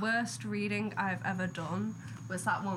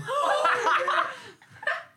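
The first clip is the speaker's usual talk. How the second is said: loud burst of laughter; 7 dB louder than usual. Real, not acted. Reacting to Hanged Man card.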